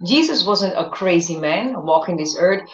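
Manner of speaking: ordinary talk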